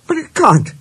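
high-pitched, disbelieving objection on CAN'T; objecting. A narrator's reading. Engine disputes that a railway climbs mountains, objecting.

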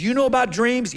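eager prisoner. Acted. Prisoner pesters Joseph about dreams.

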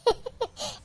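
very soft, breath-only giggle; stifled little laugh; amused giggle. Acted. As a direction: snicker quietly at the child. Grandmother amused by child's request.

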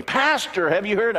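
high, loud eager question; a hammed-up eager believer; excited congregant. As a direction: grab him with the news. Another excited congregant grabs pastor.

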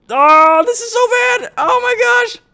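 very high squealing in pain. Real, not acted. Pins pinching his face.

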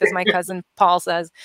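ordinary delivery

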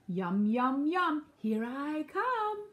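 drawn-out, greedy wolf glee; hungry villain sing-out; gloating wolf. A narrator's reading. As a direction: stretch it out, rising with relish. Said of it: "laughed the wolf".

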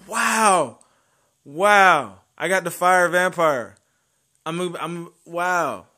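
loud, high burst of joy; real thrilled cheer; excited. Real, not acted. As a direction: shout the news out. Excited about a lucky summon.

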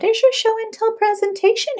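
high, bright teacher voice; a kind teacher coaxing a child; sweet teacher. A narrator's reading. Miss Harmony inviting Harry to present, a high sweet teacher voice.

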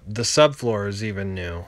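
usual speaking voice

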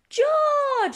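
high, loud call of complaint; high-pitched scolding shout; cross little Peppa. A narrator's reading. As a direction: call out sharply to your little brother. Peppa woken, scolds George.

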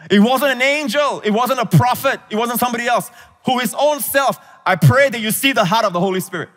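fervent preacher climax; preaching at full force. Acted. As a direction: drive it home from the pulpit.

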